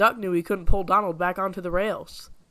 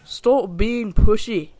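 slow, louder, cross snap; snapped; 3 semitones higher, 8 dB louder, much slower than usual. A narrator's reading. Donald snapping at his twin Douglas. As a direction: bite the words out slowly. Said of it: "Donald snapped".

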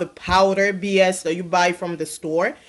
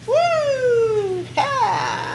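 excited whoop; 13 semitones higher than usual. Real, not acted.

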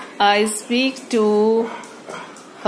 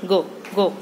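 ordinary talk, nothing marked

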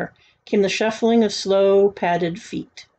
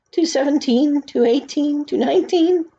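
higher, climbing count; tense counting; 7 semitones higher than usual. A narrator's reading. Boy tearing off more hats, counting ever higher. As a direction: step each number up in pitch.

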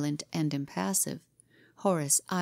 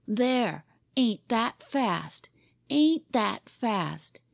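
slow, higher-pitched gloating; exuberant victory; 5 semitones higher, much slower than usual. A narrator's reading. Boy pins down his rival after winning, exuberant. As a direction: press the point, drawing out each word. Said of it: "He was exuberant with victory".

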